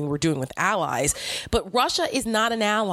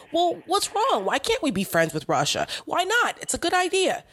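much higher mock voice of a Trump supporter; a Trump fan asking why not, voiced much higher; 11 semitones higher than usual. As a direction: pitch it way up to mock their question. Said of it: "Trump people say".

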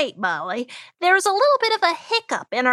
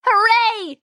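bright, higher cheer; excited cheer; 7 semitones higher, 3 dB louder than usual. Acted. Co-host cheering the segment lineup.

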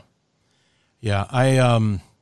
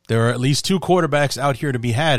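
normal talking voice

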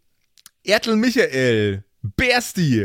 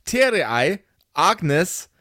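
ordinary delivery

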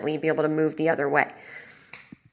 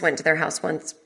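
usual speaking voice

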